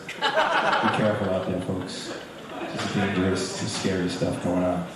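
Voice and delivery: low mock-ominous voice; deep, tongue-in-cheek cautioning; mock warning. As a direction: warn them with hushed, fake gravity. Mock warning after silly title.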